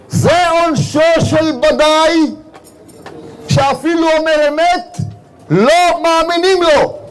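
a patriarch's unhurried rebuke; slow, weighty verdict. Retold or imitated. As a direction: draw each word out slowly and calmly. Abraham's calm answer to Satan. (תשובה איטית ושקולה של אברהם לשטן)

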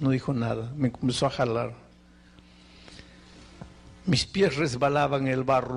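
normal talking voice

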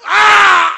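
long, very loud high wail; acted scream. Tamar leaving after the abuse, screaming. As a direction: scream out as the wronged woman. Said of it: "y se fue gritando".

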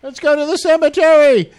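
high, loud mock-panicked yell; hammed-up high-pitched cry; mimicked horror-movie victim. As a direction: parody a horror-movie character calling out a terrible plan. Mimicking the commercial's panicked characters.

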